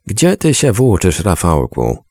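a grandmother's stern, dry rebuke; sharp opening, scolding grandmother; dry and curt. A narrator's reading. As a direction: clip it short and cold at the boy. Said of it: "powiedziała oschle".

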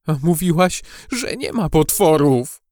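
frightened, accusing character voice; fearful reproach. A narrator's reading. Gucio reminds Maja she denied monsters, fearful reproach. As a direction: blame your friend while still scared.

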